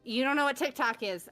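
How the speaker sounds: usual speaking voice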